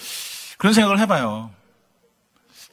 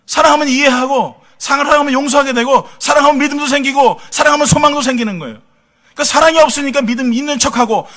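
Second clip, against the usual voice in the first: rising preaching fervor; 6 semitones higher, 6 dB louder than usual. Real, not acted. Preacher building to emphatic point.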